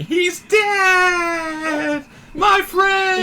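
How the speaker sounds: high loud grief-stricken scream. Retold or imitated. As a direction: scream it to the sky. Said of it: "should have screamed out going".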